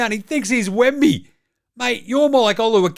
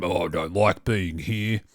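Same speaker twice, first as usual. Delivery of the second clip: lower, much softer sulky mumble; mopey LeBron; 7 dB quieter than usual. Retold or imitated. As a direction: mope it like a bored star dragged along. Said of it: "LeBron's sitting there going".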